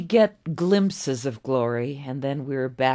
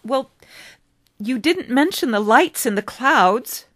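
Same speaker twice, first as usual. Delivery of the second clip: put-on eager boy David; 10 semitones higher, 8 dB louder than usual. David challenges the old man.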